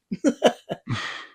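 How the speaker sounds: short high burst of laughter. Real, not acted. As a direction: laugh at your own joke. Laughing at her own line.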